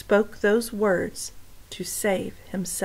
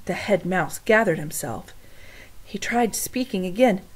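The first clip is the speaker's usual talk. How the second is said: usual speaking voice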